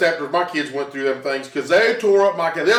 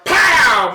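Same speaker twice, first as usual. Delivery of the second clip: pow, shouted higher and louder; 6 semitones higher, 7 dB louder than usual. Retold or imitated. Father retelling the cabinet snapping on fingers, loud pow. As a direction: slam the word out like a trap snapping.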